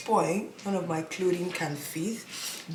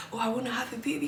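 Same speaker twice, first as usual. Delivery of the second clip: mock baby-crazy gushing; 8 semitones higher, much faster than usual. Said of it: "kind of person".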